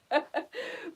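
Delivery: quick high laugh; a little embarrassed laugh; short laugh. Real, not acted. Laughs after "she'll kill me.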